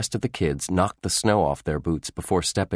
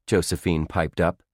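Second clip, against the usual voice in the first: ordinary delivery, nothing marked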